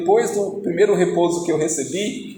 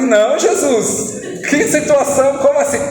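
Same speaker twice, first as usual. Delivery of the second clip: shocked protest; 7 semitones higher than usual. Retold or imitated. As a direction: balk at the order in disbelief. Preacher protests to Jesus.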